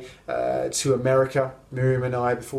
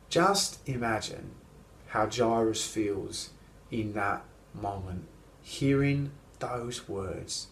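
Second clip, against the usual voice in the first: solemn hush; 4 semitones lower, 5 dB quieter than usual. Real, not acted. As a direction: let each phrase sink in quietly. Solemn reflection on the death.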